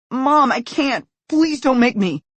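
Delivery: high, loud desperate pleading; begging. Acted. Frightened son begs his mother, high and pleading. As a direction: beg her to let you off.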